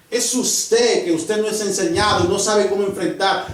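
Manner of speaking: loud, driving pulpit preaching; preaching at full force. Acted. Preacher warning the congregation about trials, loud full-force preaching. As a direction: hammer the warning home to the church.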